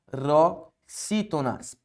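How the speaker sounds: "paroxítonas" slow, with pauses after pa and ro; syllable by syllable. Shown as a demonstration. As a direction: break "paroxítonas" into pa, ro, xítonas. Teacher sounds out term, then repeats.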